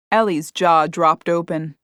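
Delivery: ordinary delivery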